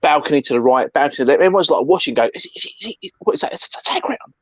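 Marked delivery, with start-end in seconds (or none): 2.3-4.3 breathy whispered question, pitched up; puzzled whisper. Retold or imitated. Bystanders in the alley, whispering in confusion.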